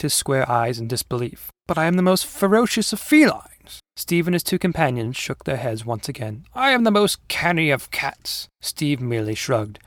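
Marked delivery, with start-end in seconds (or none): 1.7-3.8 high, proud tiger voice; indignant boast in a raised pitch; boastful paper tiger. Acted. Tiger protests his fame. 6.5-8.5 higher-pitched proud declaration; boastful paper tiger. Acted. Tiger insists on his fame.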